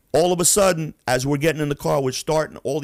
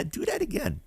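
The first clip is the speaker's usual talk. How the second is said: a hint of a smiling, softer threat; smirking mock threat; 13 semitones higher, 6 dB quieter than usual. Frankie warning the player, lightly voiced smirk. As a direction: tease it with a smirk. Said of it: "he'd be smiling and smirking".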